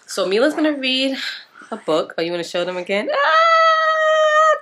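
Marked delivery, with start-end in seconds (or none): 3.1-4.6 long, very high loud squeal. Real, not acted. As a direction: let out a piercing, drawn-out squeal. Child squeals, showing off to camera.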